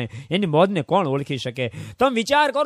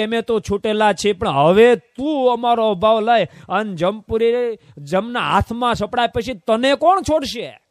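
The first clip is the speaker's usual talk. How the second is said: stern warning; 6 semitones higher, 5 dB louder than usual. Acted.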